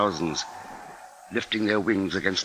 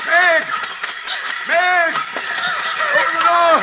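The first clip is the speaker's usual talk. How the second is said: much higher, loud frantic calls to his wife; desperate shouting; 7 dB louder than usual. Acted. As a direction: yell for the door at full voice. Calling wife to open door.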